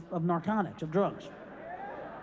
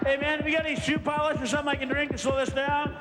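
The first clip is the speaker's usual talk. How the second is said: panicky pleading; 5 semitones higher than usual. Retold or imitated. Overdosing, begging for something.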